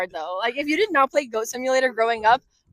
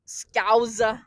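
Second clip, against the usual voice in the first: drawn-out "Scouser" in mock Scouse; Scouse accent imitation. Friend echoing the word, attempted Scouse accent. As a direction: try "Scouser" in put-on Scouse. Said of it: "the Scouse accent".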